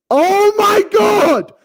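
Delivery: drawn-out, high-pitched holler; exclaiming shout. Pretend. Podcast host erupting before his impression, exclaiming shout. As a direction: yell it long and high.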